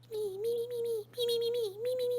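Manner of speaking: very high squeaky tiny leaf chirping; cute little creature noise. Acted. Tiny leaf greets Holb with a very high cute squeak. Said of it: "it's making this cute little noise".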